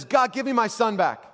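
high, loud cry to God; desperate plea. Retold or imitated. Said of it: "in desperation".

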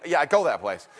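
normal talking voice

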